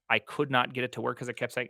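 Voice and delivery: ordinary talk, nothing marked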